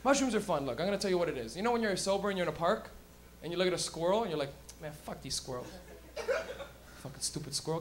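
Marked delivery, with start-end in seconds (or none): 4.8-7.8 low, soft dismissive grumble; understated grumble. Acted. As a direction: grumble it under your breath. Sober person grumbling at squirrels.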